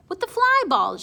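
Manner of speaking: put-on sneaky, sing-song girl voice; sing-songy girl. A narrator's reading. Girl teasing the boy about the contest, sing-songy. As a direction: tease it in a lilting sing-song. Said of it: "a sneakily sing-songy girl voice".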